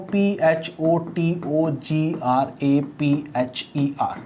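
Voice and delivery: slow, letter-by-letter spelling of the longer word. Shown as a demonstration. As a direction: spell each letter slowly to the end. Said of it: "जैसे मैं आपसे कहूँ". (अक्षर-अक्षर धीरे-धीरे spelling)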